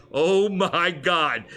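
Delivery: retold shock. Angler recalls a shadow circling him, drawn-out shock. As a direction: stretch every word in replayed awe.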